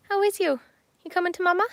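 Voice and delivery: high sing-song voice for the cat. Real, not acted. Baby talk to the cat.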